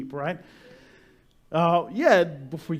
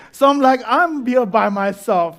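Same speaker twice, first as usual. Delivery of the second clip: laughing through the line, louder; 5 semitones higher, 7 dB louder than usual. Real, not acted. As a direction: chuckle out the lonely fear. Laughing at own fear.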